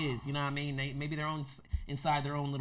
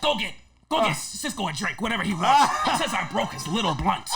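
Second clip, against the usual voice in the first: loud mock-grudging order with laughter; Rick impression; 3 semitones higher, 7 dB louder than usual. Rick ordering a drink for Cisco, laughing impression. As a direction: make grudging amends in his borrowed voice. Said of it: "He's like".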